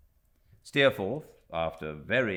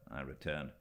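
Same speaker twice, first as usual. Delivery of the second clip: ordinary delivery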